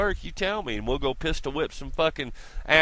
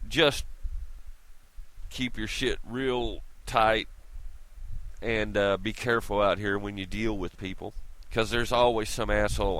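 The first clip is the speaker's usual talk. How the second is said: usual speaking voice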